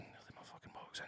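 hushed whisper in a dark cinema; retold whisper. Complaining to his girlfriend in the cinema, whispered. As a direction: whisper it so only she hears. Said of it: "I'm going".

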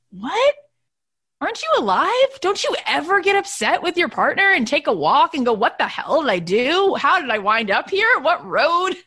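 high-pitched, exaggerated disbelief; hammed-up outburst of rhetorical questions; mock incredulity. Wife retorting to worried followers, exaggerated incredulous.

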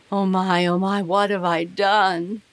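loud, anguished moan of regret. Acted. King lying awake, regretting leaving the woman, moaning loudly. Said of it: "he moaned".

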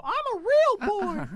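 put-on high, slow wooden-boy voice; Pinocchio impression. Friends joking about chatbot, as Pinocchio. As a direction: squeak it out like a puppet boy. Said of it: "That sounds like Pinocchio".

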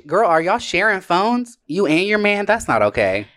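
usual speaking voice

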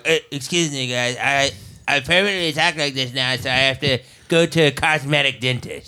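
jaw pushed forward, dopey voice; underbite voice. Pretend. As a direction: talk through a jutting underbite.

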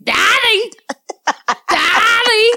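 very high squawked imitation of a little boy; toddler's "daddy". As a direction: screech "Daddy" like a demanding kid. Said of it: "That's how he says daddy".

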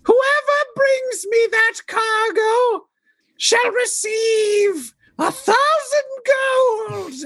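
very high, slow panicked shriek; drawn-out falsetto screech, grandly proclaiming; Monty Python old lady. Acted. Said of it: "You sound like, like Graham Chapman and John Cleese doing the two old ladies".

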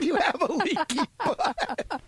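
high, slow mock taunt; teasing. Teasing his partner about the leaky butt, slow mock taunt. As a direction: draw out the taunt, hammed-up.

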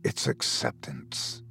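slow, rattling whisper; icy whisper. A narrator's reading. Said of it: "Doctor Cobb whispered".

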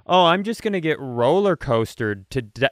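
ordinary delivery

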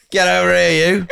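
high, laughing mock summons; mock stern teacher. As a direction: bark it like a stern old head teacher, half laughing. Teacher's finger-pointing summons.

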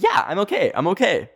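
high, forced reassurance; insisting she's fine, pitched high; shaky brave okay. Retold or imitated. To worried friends, a high insistent okay. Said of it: "And I'm like".

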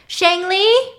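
high, loud summons; calling out. Retold or imitated. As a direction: call a child in from the next room, acted. Villain summons the little girl.

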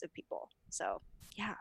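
whispering. Real, not acted. Trailing off to a whisper.

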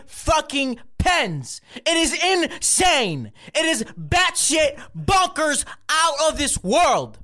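high, slow, shouted outrage at the pens; over-the-top exasperated shouting; exasperated rant. Real, not acted. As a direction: rant like it's the end of the world. Cold open rant about pens.

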